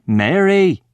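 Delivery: high, fairly loud calling shout. A narrator's reading. Mister Miller to his wife from the living room, shouting. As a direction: call out loud enough to carry. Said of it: "Mister Miller shouts".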